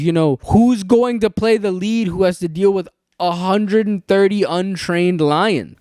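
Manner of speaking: normal talking voice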